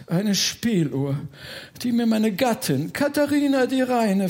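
high-pitched, grandly dramatic nobleman; plaintive ghost baron. Acted. Baron shows his beloved broken music box to Bertha, plaintive. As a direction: lament your broken treasure, rising in pitch to the end.

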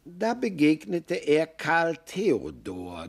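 ordinary delivery, nothing marked

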